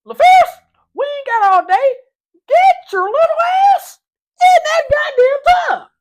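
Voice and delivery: very high, loud shouting coach voice; coach's yell. Retold or imitated. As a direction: yell it like the squinting coach, slowing the end. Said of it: "he talks like this".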